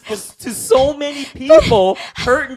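high, loud, heated protest; indignant outburst. Real, not acted. Indignant outburst about scammer.

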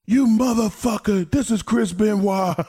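high, loud mock yell; playful high shout down the phone; Chris Benoit yelling. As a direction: bellow it up high like a buddy busting your chops. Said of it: "And he's like".

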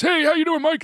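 high, bright-pitched nasal-free uncle voice jumped way up; impression of Tom Gamble. [name] imitating Tom Gamble saying hi, high voice. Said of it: "And he talks like this".

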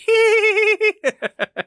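shrill goofy giggle, way up high; silly high laugh. Pretend. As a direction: cackle like a cartoon. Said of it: "this is how I'm gonna laugh".

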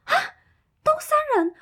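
高声惊叫“东山人”; 尖着嗓子惊喜地喊; surprised shriek. Retold or imitated. Excited discovery of shared hometown. (高八度地惊呼认出同乡)

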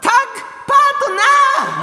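high-pitched team-call yell; shouted catchphrase. Pretend. Radio performer hails the new sponsor tie-up, high shout. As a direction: belt out the catchphrase.

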